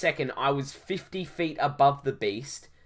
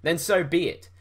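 mild heroic shout, a little raised; 5 semitones higher, 5 dB louder than usual. Acted. Hero to the behemoth in an air battle, mildly shouted. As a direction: call it out defiantly. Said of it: "I shouted".

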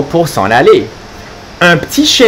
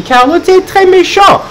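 much higher sing-song "méchant"; playful scolding. Acted. Reader teasing Carlo for being mean. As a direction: tease the naughty pug from way up high. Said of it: "scolding the pug playfully".

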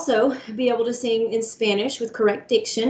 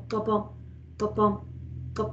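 steady heartbeat imitation; 8 dB quieter than usual. Shown as a demonstration. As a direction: voice a calm heartbeat with pauses between beats. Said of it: "we want a slow, steady heartbeat".

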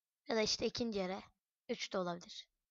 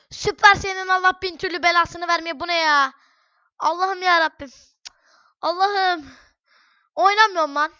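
higher, louder angry rant; exasperated cursing; 5 semitones higher, 8 dB louder than usual. Real, not acted. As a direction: curse the game in loud, wailing frustration. Curses the game after losing attack. (sinirli, yüksek sesle isyan)

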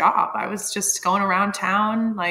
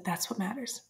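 whispered wisdom; 11 dB quieter than usual. Retold or imitated. Nurse telling the mother what matters, whispered. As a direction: whisper it softly, unvoiced.

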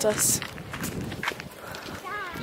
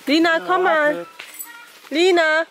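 calling out; 4 semitones higher than usual. Real, not acted. Mother calling child across farm.

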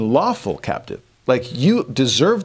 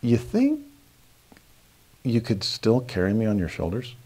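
sheepish daughter; 4 dB quieter than usual. Retold or imitated. Dad imitating his twelve-year-old daughter asking sheepishly. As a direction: ask timidly, half embarrassed. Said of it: "And kinda sheepishly".

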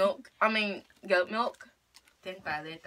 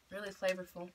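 talking around candy on lips; candy held at lips; 2 semitones lower, 7 dB quieter than usual. Real, not acted. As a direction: lips partly blocked by candy. Seen 0: Left girl presses red candy to her lips while talking.

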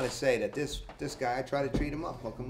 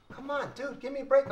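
exasperated plea; 12 semitones higher than usual. Real, not acted. Pleading with the doorbell ringer, high and exasperated. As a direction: beg the ringer to stop.

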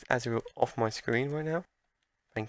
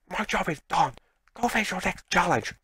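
game character voice; 6 semitones higher, 3 dB louder than usual. Acted. Voicing a beaten opponent's line.

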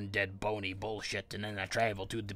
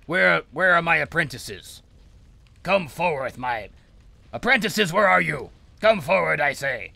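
loud, high pompous summons; hammed-up wizard bellowing for his servants; mock-grand master calling his apprentices; 4 semitones higher, 7 dB louder than usual.

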